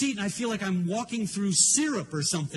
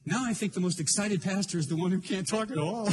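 ordinary talk, nothing marked

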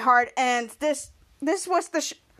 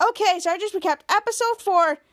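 ordinary talk

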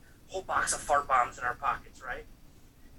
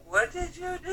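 imitated crying; 3 dB louder than usual. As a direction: wail it like someone in tears. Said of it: "just starts fucking crying".

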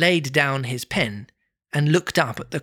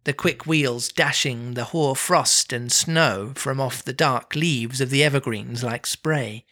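usual speaking voice

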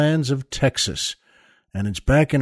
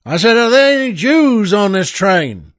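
louder and higher repeat of the question; yelling again; 5 semitones higher, 5 dB louder than usual. Acted. Texan gunman demanding an answer again, yelled. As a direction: bellow it at the whole car. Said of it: "the Texan yells again".

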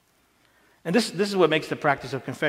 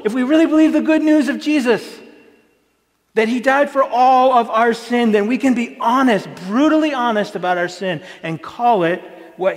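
higher and louder, rising to full preaching force; preaching climax; 6 semitones higher, 6 dB louder than usual. Acted. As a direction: drive the gospel point home to the congregation.

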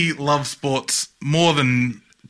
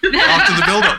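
laughing; 5 dB louder than usual. Real, not acted. Laughing through the punchline.

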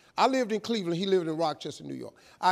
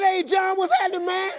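high, loud over-eager welcome; out-cheer the cheerful caller; exuberant greeting; 11 semitones higher, 4 dB louder than usual. Retold or imitated. Man showing his sponsor a new attitude, exuberant. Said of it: "with the right attitude".

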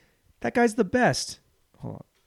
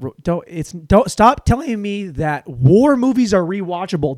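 usual speaking voice

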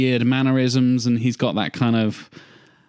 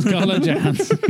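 laughing; 8 semitones higher than usual. Real, not acted. Laughs at a joke casting suggestion.

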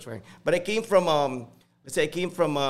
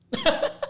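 a burst of high laughter; 3 dB louder than usual. Real, not acted. Recalling he couldn't relate, bursting into high laughter. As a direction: laugh at the memory. Laughing at own culture shock.

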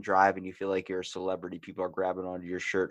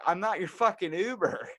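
retold indignation; 9 semitones higher than usual. Retold quarrel with drunk stranger.

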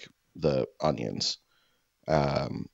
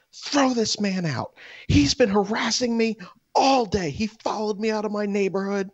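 shrill, much higher mock hysterical wail; hysterical woman. Shopper demanding staff throw the man out, mock hysterical high wail. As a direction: screech it like a woman losing her mind, near tears. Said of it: "this woman's saying".

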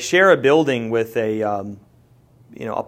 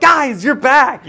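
loud, high, drawn-out mock greeting; excited shout; 13 semitones higher, 10 dB louder, much slower than usual. Restaurant staff greeting returning diners at the table, excited shout. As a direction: holler it slowly, pitched way up, like an overjoyed welcome.

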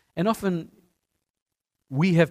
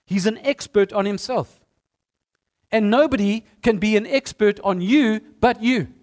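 building sermon emphasis; 6 semitones higher, 6 dB louder than usual. Acted.

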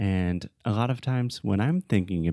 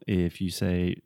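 normal talking voice

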